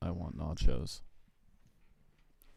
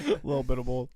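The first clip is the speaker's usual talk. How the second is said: laughing; 5 semitones higher, 4 dB louder, faster than usual. Real, not acted.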